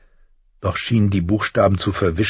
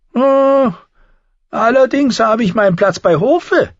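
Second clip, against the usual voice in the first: high, louder, amused dwarf voice; smiling Tyrion; 5 dB louder than usual. A narrator's reading. Tyrion answers Thorne with a smiling high voice. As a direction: smile through the retort. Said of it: "entgegnete der Zwerg lächelnd".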